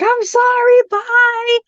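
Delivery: high-pitched, squeaky retold fright; mock-terrified, very high voice; panicked apology. Retold fleeing from the spirit.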